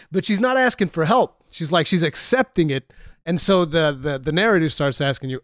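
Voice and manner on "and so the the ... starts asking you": usual speaking voice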